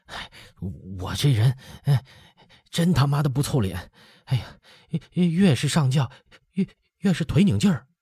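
groaning high through stomach pain; stretching groan. Acted. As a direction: moan the words out, pained. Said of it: "呻吟着说". (拉长声呻吟着说)

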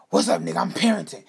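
loud, irritated phone answer; acting out a harried parent; retold angry greeting. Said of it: "Why you sound so angry?".

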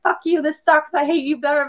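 venting like you've hit the top of your cup; mock angry rant. Wife ranting at her husband about doing everything, mock angry. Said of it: "you're on one of your, like, rants".

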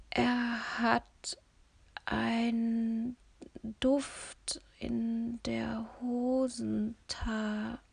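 struggling to speak, whispered and slow; forcing out words half asleep; drowsy whisper. A narrator's reading. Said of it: "das Sprechen war trotzdem noch anstrengend". (schläfrig und mühsam geflüstert)

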